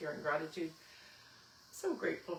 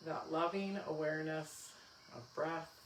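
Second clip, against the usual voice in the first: guided meditation; 5 dB quieter than usual. Acted. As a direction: guide them gently into stillness. Leads final relaxation.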